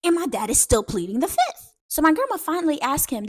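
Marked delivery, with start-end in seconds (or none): none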